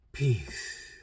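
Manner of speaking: soft, drawn-out release of the word; imitating a calming exhale; imitated calm. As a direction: let it out softly, like a sigh. Demonstrates the calming word with sigh.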